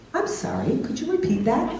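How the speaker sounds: lightly voiced virtual assistant, rising at the end; a hint of automated-assistant politeness. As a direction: politely query like a digital helper. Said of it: "when he talks to Alexa".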